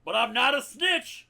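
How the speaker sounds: gruff bellowing army colonel; Frank Slade. Acted. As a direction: roar it like a blind old colonel. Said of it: "Lieutenant Colonel Frank Slade".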